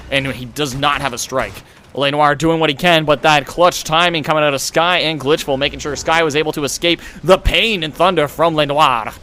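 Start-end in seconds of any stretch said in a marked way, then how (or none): none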